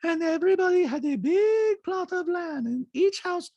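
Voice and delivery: put-on very high-pitched accented caricature; squeaky falsetto mimicry of a reality star; Diana impression. Host mimics reality star in recap, high-pitched caricature. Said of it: "Diana, in a talking head".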